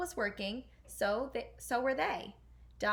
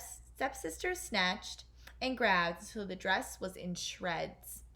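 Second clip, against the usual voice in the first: plain reading voice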